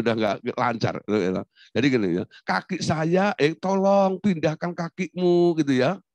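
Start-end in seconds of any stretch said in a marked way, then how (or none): 2.4-5.6 higher, drawn-out polite request; gentle Javanese politeness. Acted. Javanese asking someone to move their foot, very polite. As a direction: imitate a refined, indirect plea, endings stretched. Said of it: "tapi omongannya halus".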